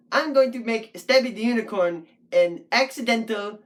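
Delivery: ordinary talk, nothing marked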